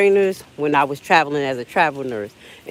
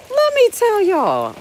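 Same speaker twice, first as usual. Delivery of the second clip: squeaky high-pitched exclamation; high dramatic announcement; slower than usual. Real, not acted. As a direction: pitch it way up, slow.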